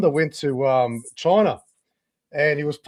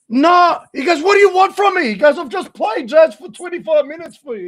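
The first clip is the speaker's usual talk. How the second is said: much higher, louder indignant mock rant; mock exasperated yell; 11 semitones higher, 7 dB louder than usual. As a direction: play it up as a fed-up star.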